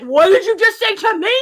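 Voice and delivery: loud, acted indignation; angry challenge. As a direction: snap it as a mock confrontation. Acting Wanda confronting Geraldine.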